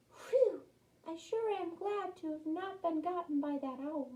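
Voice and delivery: high, soft little mouse voice; relieved mouse. A narrator's reading. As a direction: sigh it out small and high, relieved. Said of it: "says the mouse".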